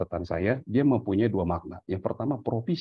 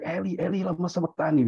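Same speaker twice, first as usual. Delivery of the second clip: higher, pleading cry from the cross; mock the cry to the Father, higher; imitated cry of anguish; 8 semitones higher than usual. Son crying out to his father, imitated higher cry. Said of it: "Ketika dia berteriak".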